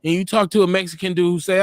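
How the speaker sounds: normal talking voice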